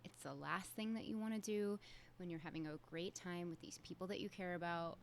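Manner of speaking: usual speaking voice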